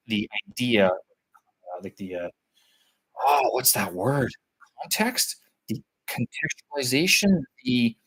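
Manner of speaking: normal talking voice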